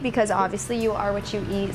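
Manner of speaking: ordinary delivery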